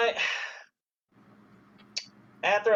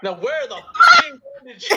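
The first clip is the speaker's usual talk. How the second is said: mock shout; 12 dB louder than usual. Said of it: "could you ask him where the bandages are".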